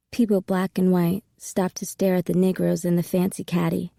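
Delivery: ordinary delivery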